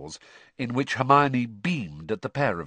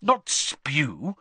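impatient; 5 semitones higher than usual. Acted. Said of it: "said Hermione impatiently".

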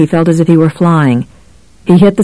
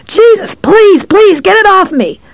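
panicked pleading. A narrator's reading. Bird tearing at his scalp.

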